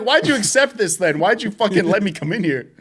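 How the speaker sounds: mock outrage. Worker blaming homeowner, high mock-indignant voice. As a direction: protest like you're wronged. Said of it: "I'm like".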